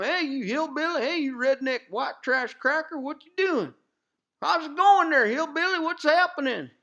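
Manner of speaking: high, jeering hillbilly taunt; mocking taunt. Mocking others taunting him.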